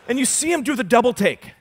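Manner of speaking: ordinary talk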